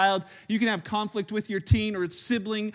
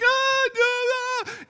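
put-on high whiny protest of a kid; mock kid freakout; 3 dB louder, much slower than usual. Father imitates his kids freaking out, high whine. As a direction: squeal a child's refusal, high pitched. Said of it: "freaking out right away".

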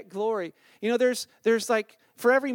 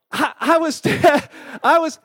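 laughing outburst; 6 semitones higher, 7 dB louder, slower than usual. Real, not acted. Laughing burst mid-sentence.

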